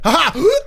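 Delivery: high, loud cartoony ha ha; cartoon laugh. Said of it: "just that".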